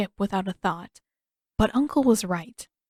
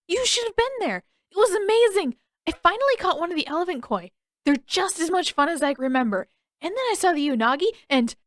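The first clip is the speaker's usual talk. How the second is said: put-on much higher, fast, bubbling boy's voice; excited young Aang; 9 semitones higher, faster than usual. Aang gushing to Katara about the koi, bubbly high boy. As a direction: tumble the words out, thrilled.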